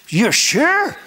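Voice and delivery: high, doubting question; incredulous. Retold or imitated. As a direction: act out amazed double-checking. Acts his disbelief at healing.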